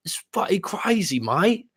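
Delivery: high-pitched mock London accent. Host imitating a guy griping about her friends, London accent. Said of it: "a London accent".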